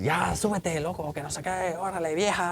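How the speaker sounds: a touch of a Veracruz jarocho scolding his brother; habla jarocha. Retold or imitated. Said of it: "Así bien jarocho". (imitando el habla jarocha, regañando al hermanito en voz baja)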